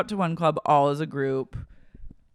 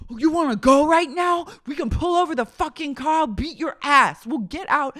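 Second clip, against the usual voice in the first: much higher, louder mock threat; full Hulk threat; 10 semitones higher, 4 dB louder than usual. J wow threatening Angelina in the car, loud mock fury. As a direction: come at her like you'll pull the car over. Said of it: "starts going full Hulk".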